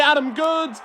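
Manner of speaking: a commentator's rising excited call. Acted. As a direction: call the player's name high and sharp. Excited live play-by-play call.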